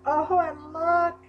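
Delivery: thrilled, high-pitched gushing; delighted gushing. Real, not acted. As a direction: coo excitedly over something cute. Delighted at cute items in package.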